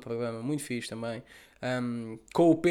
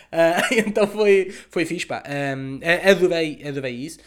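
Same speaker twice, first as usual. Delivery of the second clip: ordinary talk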